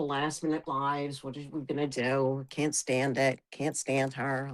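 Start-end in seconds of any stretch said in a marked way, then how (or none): none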